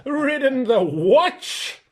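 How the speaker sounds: slow, high, loud whinny through the words; neighing contempt. Acted. Horse scorns Shasta for only riding a donkey, neighing. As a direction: let the question come out as a scornful neigh. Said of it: "it came out in a sort of neigh".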